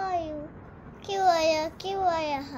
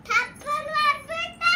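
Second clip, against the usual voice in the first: much higher and louder, fast squeal; loud playful threat; 10 semitones higher, 7 dB louder, much faster than usual. Pretend. Child squealing a cheeky threat at mother, loud.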